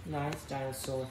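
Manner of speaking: calm the beast softly; soothing. Pretend.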